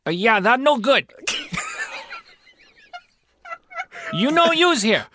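high, cartoonish Chinese-restaurant-owner accent; hammed-up pidgin accent, pitched high; fake Chinese accent.

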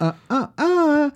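high singsong uh-uh-uh; mock scolding. Host as police refusing drug couriers, high mock scolding. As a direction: wag a finger with your voice, pitched high.

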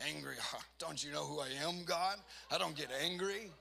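affronted, building denial; indignant denial. Retold or imitated. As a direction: protest as if insulted to be asked. Indignant denial acted out to God.

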